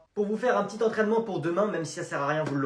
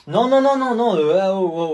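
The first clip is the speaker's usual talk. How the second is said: loud protest; 3 semitones higher, 8 dB louder than usual. Acted. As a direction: cut them off loudly. Refus crié. (refus crié par la tante)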